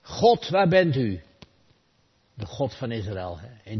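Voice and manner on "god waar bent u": a slow, loud, higher cry to heaven; desperate cry. Retold or imitated. Prisoner in the crowd cries out to God in despair. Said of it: "uit de schare klonk een stem".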